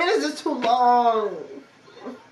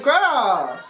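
usual speaking voice

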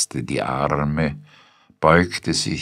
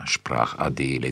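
ordinary delivery

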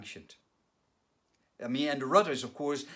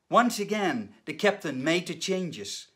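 normal talking voice

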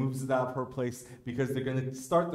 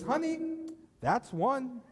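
high, calm deadpan warning; deadpan wife; 12 semitones higher, slower than usual. Retold or imitated. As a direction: say it sweetly and coldly, higher. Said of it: "she turns to him and says".

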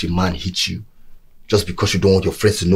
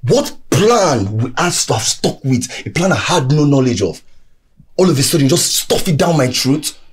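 higher and louder, indignant; angry outburst; 6 semitones higher, 3 dB louder than usual. Acted. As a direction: hurl the complaints back at her. Angry quarrel with partner.

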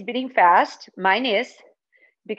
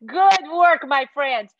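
laughing praise; 2 semitones higher, 5 dB louder, slower than usual. Real, not acted. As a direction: praise them through a laugh. Laughs after animal noises with kids.

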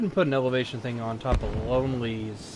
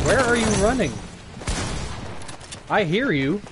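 loud, higher taunting call to a running enemy; 6 semitones higher, 4 dB louder than usual. Real, not acted. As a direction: shout after him teasingly. Calling out to enemy.

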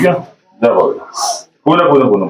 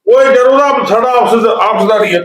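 much higher, hot defensive burst; exasperated outburst; 10 semitones higher than usual. Acted. Sarpanch cuts his wife off mid-quarrel, exasperated. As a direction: cut her off with a pitched-up protest.

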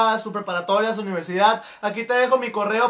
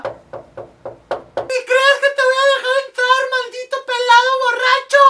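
much higher, slow, shrill wife's scolding; angry wife; 13 semitones higher, 3 dB louder, slower than usual. Acted. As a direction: scold him like a furious wife.

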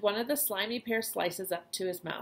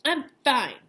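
put-on louder, higher, defiant boy's snap; fierce; 5 semitones higher, 7 dB louder than usual. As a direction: bite it out so she backs off. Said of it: "told Grandma fiercely".

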